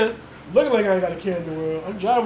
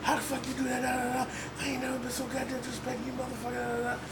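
nagging voice in head; 5 semitones higher, 11 dB quieter than usual. Retold or imitated. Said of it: "just constantly in my head".

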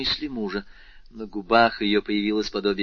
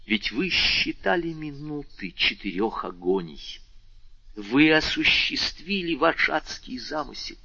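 building, higher-pitched denunciation; rising heat; 6 semitones higher than usual. A narrator's reading. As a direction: pile up the accusations with growing fervour. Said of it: "продолжал Вильфор с возрастающим жаром".

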